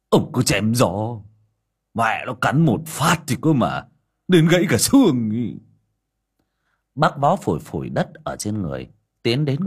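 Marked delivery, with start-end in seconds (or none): 0.1-5.5 grumbling retort. A narrator's reading. Vó grumbles about the dog.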